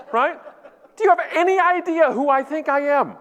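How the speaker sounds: high-pitched, hammed-up diva tantrum; social media diva. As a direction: make imperious demands in mock outrage. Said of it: "a social media diva".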